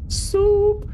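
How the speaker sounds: very high falsetto pop-up cry; comic soup greeting. Acted. Soup greeting the driver at the stop sign, comic high squeal. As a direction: squeal it like a surprise visitor.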